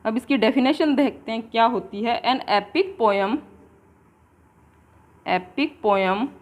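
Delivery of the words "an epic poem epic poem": very slow and drawn out; slow dictation. Shown as a demonstration. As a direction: dictate it slowly for notes. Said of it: "dictating definition slowly".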